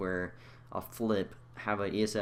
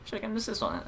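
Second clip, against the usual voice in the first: much higher, whining; whiny complaint; 14 semitones higher, faster than usual. Real, not acted. Gamer to viewers, a high whine. Whining about missed assist.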